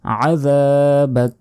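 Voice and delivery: আযাবাক ending on bare k; slow chanted আযাবাক, final vowel dropped; stopping with sukun. Shown as a demonstration. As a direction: stretch the middle of আযাবাক, cut it at k. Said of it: "এভাবে বলা যাবে".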